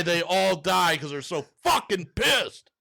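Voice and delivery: high, slowed-down mock-furious finish; hammed-up outrage, drawn out; impassioned. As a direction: land it slowly, still worked up. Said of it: "Very impassioned".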